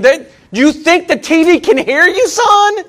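high-pitched, incredulous fatherly chiding; mock scolding dad. Father to son yelling at the TV, mock scolding. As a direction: needle him, pitch climbing on the last word.

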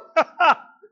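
high, loud burst of laughter; exhilarated short cackle. Real, not acted. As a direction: laugh out loud after a big holler. Laughs after his own shout.

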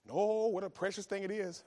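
ordinary delivery

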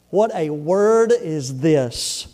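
drawn-out, pondering emphasis; weighty repetition. Acted. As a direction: linger over every word. Repeats the key verse for weight.

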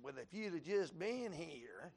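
slow, mock hands-on-hips reproach; sassy reproach. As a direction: throw it at the husband with a hint of sass. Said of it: "hands on your hips".

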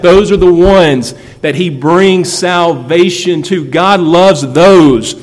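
building preaching. Acted. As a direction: build the list into a full-voiced call. Rising sermon climax.

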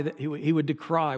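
ordinary talk, nothing marked

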